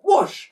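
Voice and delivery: high loud whoosh falling away; high swooping whoosh; whoosh sound. Acted. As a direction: sweep the whoosh down like passing overhead. Sound effect of flight.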